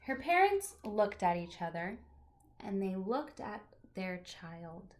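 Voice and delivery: gentle, expressive picture-book reading; reading to children. Acted. Teacher reading a picture book aloud to her class, storytelling voice.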